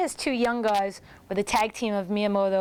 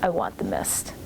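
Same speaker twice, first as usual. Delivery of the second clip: imitating Dan; 13 semitones lower than usual. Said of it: "And Dan's like".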